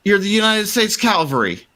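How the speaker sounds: high, shaky voice, relieved and babbling; a survivor thanking the posse; frantic survivor. Acted. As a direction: gush with relief at being rescued. GM holds panicky NPC voice.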